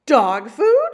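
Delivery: high, loud outraged shriek. Acted. Store manager shrieking at the girl, high shriek. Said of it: "shrieked the manager".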